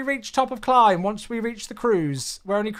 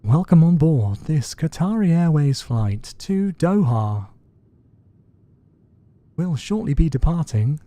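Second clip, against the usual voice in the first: airline boarding announcement; 5 semitones lower, 7 dB louder than usual. Pretend. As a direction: deliver a measured, projected welcome-aboard announcement. Plays airline cabin announcer.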